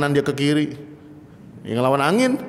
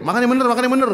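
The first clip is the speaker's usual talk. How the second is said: mock Betawi command, pitched up; bossy Betawi host; 7 semitones higher, much faster than usual. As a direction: boss the guest into eating right. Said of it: "nyuruhnya pakai".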